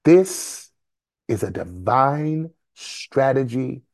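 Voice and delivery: rhythmic preacher testimony building into exhortation; preaching. Real, not acted. Preacher testifying about hearing God, preaching. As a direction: preach it emphatically, stretching and pausing on key words.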